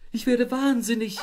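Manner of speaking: high, loud frantic shriek; Frauchen's shriek. A narrator's reading. Housewife shrieking at the broken fridge. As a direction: shriek it in despair. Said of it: "Frauchens Schrei".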